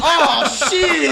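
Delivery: high startled yelp, laughing; startled shout. Retold or imitated. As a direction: imitate a hunter caught off guard, laughing. Hunter sees bird falling.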